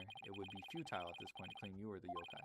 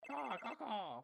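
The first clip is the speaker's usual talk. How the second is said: crow caws; 13 semitones higher, much slower than usual. Acted. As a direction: caw like a demon crow. Yokai reappears cawing.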